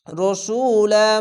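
রসুলা with the 'su' vowel held long; long vowel drawn out. Shown as a demonstration. Teacher showing one-alif lengthening, রসুলা drawn out on 'su'. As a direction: read রসুলা very slowly, stretching the middle. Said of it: "এক আলিফ টেনে পড়বেন".

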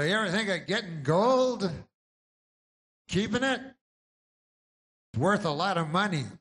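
high, loud hard-sell TV pitchman; pushy pitchman. Retold or imitated. TV gold ad pitchman pushing the viewer, high and loud. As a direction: sell gold like a late-night TV ad.

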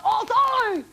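loud high shout; mock shout of the fax headline. Shown as a demonstration. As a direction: shout it out, surprised. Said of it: "ben onun için bağırdım böyle".

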